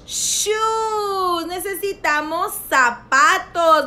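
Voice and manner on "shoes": "shoes" with a held SH, higher; stressed initial SH. Shown as a demonstration. Teacher finishing sound practice, "shoes" with long SH. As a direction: hold the "sh", then voice "shoes" high. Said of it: "Can you practice that sound".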